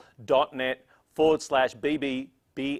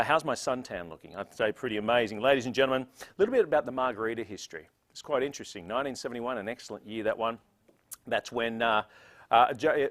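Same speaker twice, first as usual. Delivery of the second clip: usual speaking voice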